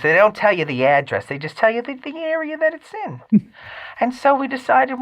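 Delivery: rambling, put-on high mother voice; mom's affected voice. Mother tells son about house hunting, mimicked in affected voice. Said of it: "without the annoying affected, uh, voice".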